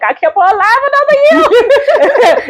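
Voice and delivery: high-pitched, hammed-up weepy wail; mock tearful cry. Mom retelling crying on daughter's birthdays, mock tearful. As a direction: imitate your own birthday crying, half laughing. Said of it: "I would cry. I'd be like".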